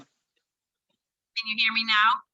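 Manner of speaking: tinny bad phone line; muffled through a weak connection; tin can phone. Real, not acted. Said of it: "sound like you're talking through a tin can".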